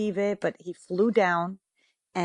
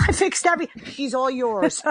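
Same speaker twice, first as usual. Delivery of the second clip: laughing, higher mock handover; laughing quote; 6 semitones higher than usual. Ex-husband handing her over to Michael, laughing. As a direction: giggle through the ex's joke. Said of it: "Mark said".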